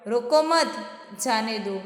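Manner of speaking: "रोको मत" together, pause, then "जाने दो"; pause after मत. Shown as a demonstration. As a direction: break after "मत", not after "रोको". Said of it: "मैं रीडिंग करती हूँ".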